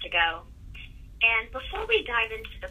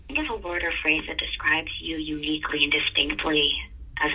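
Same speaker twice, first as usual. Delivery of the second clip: guided meditation; 2 semitones lower than usual. Acted. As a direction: lead the breath calmly. Leads a breathing exercise.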